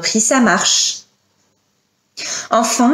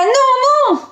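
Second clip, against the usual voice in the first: high-pitched cry of alarm; high, pleading mother's cry; 14 semitones higher than usual. Acted. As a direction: cry out to stop a killing. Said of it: "une voix cria".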